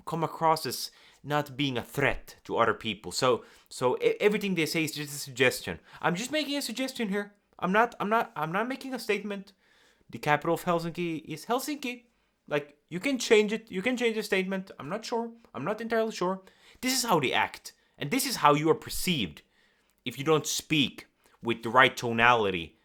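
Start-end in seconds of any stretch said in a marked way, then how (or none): 6.0-16.4 higher, quick and hesitant mock of a timid speaker; unsure nervous person. Shown as a demonstration. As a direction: sound like you're afraid to commit.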